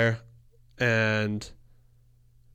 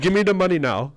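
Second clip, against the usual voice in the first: higher, louder gruff demand; mock cabbie demand; 8 semitones higher, 5 dB louder than usual. Retelling how the cabbie demanded cash, mock louder demand. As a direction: bark it like a pushy cab driver. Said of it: "He's like".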